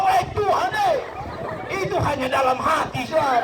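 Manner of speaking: high, comic panicked protest to God; panik memohon. Acted. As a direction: cry out high, hastily taking it back. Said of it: "Tuhan kasih angin keras". (protes panik, nada tinggi)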